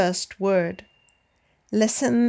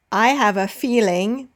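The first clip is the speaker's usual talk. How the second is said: measured listen-and-repeat reading; dictation read. Shown as a demonstration. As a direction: read it out in short chunks, pausing for repetition. Slow dictation read with long pauses.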